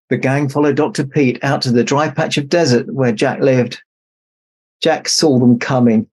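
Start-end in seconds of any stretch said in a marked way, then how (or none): none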